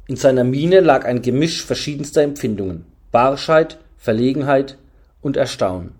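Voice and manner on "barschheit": ordinary delivery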